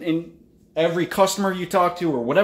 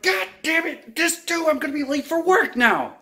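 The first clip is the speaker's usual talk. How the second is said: much higher, hammed-up complaint; mock frustration; 12 semitones higher than usual. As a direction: whine at being pulled over. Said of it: "and you're like".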